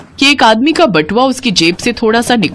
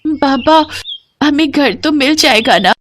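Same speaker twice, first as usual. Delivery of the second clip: put-on high child's voice, questioning; worried little daughter; 7 semitones higher than usual. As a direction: ask father anxiously, pitched up. Homeless daughter questions father. (परेशान बच्ची की ऊँची आवाज़)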